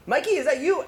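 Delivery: high, delighted cry of recognition; a judge suddenly spotting his old friend; surprised recognition. Retold or imitated. Judge spotting his childhood friend, high delighted surprise.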